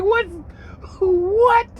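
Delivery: high-pitched, incredulous double take; acted disbelief. Man hearing Becky on the TV, acting incredulous. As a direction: blurt it high, as if baffled.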